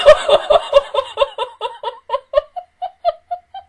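high loud cackle; cackling laugh. Real, not acted. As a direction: burst out cackling. Cracking up.

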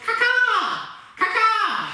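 put-on high cawing crow for the kids; crow call. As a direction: caw it out like a playful crow. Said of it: "and a crow goes".

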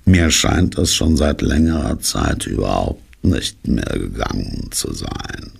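droning, sinking lament; dejected drone dying away; gloomy donkey. Acted. Gloomy donkey explains he is unwell.

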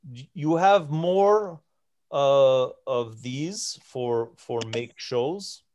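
slow, halting giant asking in pidgin; broken Common. Acted. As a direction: grope slowly for each foreign word. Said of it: "in broken common".

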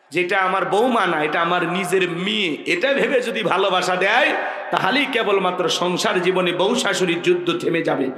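normal talking voice

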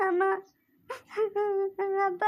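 sobbing after her parents; crying. Acted. Daughter calls to parents as they leave, crying. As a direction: let each word break into sobs. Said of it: "ಅಳ್ಬೇಡ, ಸುಮ್ನೆ ಇರು ಪವಿ".

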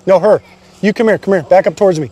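real urgent police orders; urgent shouted command. Real, not acted. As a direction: call out firm directions in a standoff. Officer directs people during standoff.

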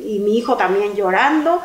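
ordinary talk, nothing marked

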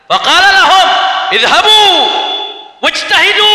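high, loud Arabic orders; acted command. Preacher voicing Iblis ordering devils away, loud acted command. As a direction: send the troops out with a ringing cry.

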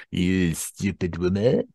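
put-on slow, hammy French accent; exasperated catchphrase in comic French accent; Gordon Kay's French accent. Sitcom Frenchman scolding a woman, mock French accent. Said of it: "doing his French accent".

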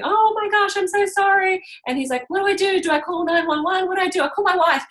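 high, loud, panicky rush of questions; frantic host. Retold or imitated. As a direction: play the flustered host who doesn't know what to do. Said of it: "he's just frantic".

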